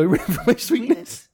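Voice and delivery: high put-on voice; commercial catchphrase. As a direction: tease the kids with the ad line. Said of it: "I go".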